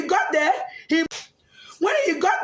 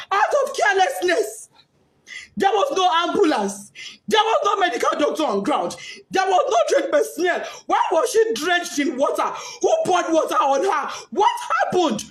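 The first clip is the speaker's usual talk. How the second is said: angry grief; 5 semitones higher than usual. Real, not acted. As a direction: hurl the questions in anguish. Mother's angry grief at school.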